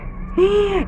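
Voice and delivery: short, high gasp; surprised gasp. Acted. Teacher gasping at the twist for her pupils. Man is the king.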